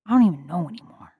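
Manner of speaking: put-on fast whispered aside in a Bronx accent. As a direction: mutter it quickly in a Bronx accent. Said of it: "I'm still working on my Bronx accent".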